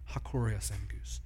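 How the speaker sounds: hushed repeating of the Greek phrase; under his breath. Retold or imitated. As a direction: repeat it like a private prayer. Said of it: "said many times under his breath".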